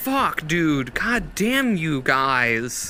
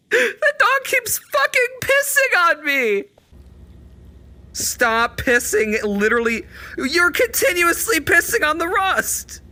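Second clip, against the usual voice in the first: exasperated yelling; 11 semitones higher, 4 dB louder than usual. Real, not acted. Streamer yelling at the dog peeing on him, high and loud. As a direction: yell at the dog to stop.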